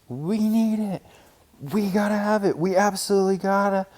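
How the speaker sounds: high, loud, breathless pleading cub; excited begging. A narrator's reading. Cubs begging Papa and Mama for toys, high and breathless. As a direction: beg like a cub who can't wait.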